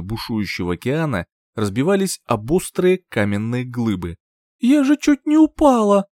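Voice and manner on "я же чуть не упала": high, loud, startled cry; dazed, shocked exclamation. A narrator's reading. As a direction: blurt it out just after nearly falling. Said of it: "пробормотала, как в тумане".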